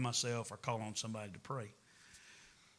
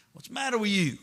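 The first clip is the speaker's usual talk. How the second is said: higher and louder, exasperated at the wife; 8 semitones higher, 6 dB louder, faster than usual. Retold or imitated. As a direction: throw it at the wife, fed up. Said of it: "say that to my wife".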